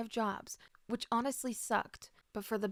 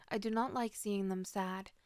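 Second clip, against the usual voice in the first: ordinary delivery, nothing marked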